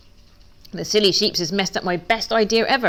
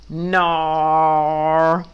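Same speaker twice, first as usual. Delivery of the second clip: loud bleating no from the flock; one lazy sheep voice saying no; sheep chorus; 4 dB louder than usual. Acted. Sheep refuse Duck with a loud bleating no.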